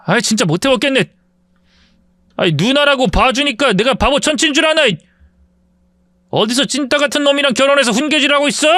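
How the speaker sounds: mock the older cousin with contempt; arrogant bully.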